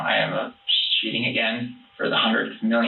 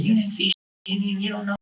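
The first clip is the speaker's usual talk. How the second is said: higher voiced defiant retort; imitating wife snapping back; 9 semitones higher than usual. Wife snaps back at husband, higher mock voice. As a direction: throw it back at him, pitched up. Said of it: "And she said".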